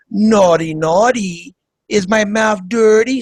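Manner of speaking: mock Eastern European accent, suggestive; teasing imitation in a put-on Slavic accent; Melania Trump. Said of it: "She talks like that".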